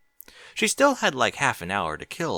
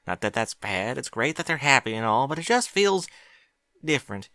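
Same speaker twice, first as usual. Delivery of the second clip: put-on higher girlish filly voice, wistful; a little farm girl pouring out her worry, pitched up; young filly Applebloom; 8 semitones higher, 4 dB louder than usual. A narrator's reading. Applebloom's voice, long complaint.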